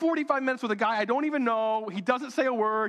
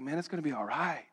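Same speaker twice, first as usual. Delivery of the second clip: mock calm friend; 4 semitones lower, 3 dB quieter than usual. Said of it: "Who are you?".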